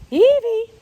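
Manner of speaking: loud high call; calling the dog. Real, not acted. Owner calling her puppy, loud and high. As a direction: shout her name across the field.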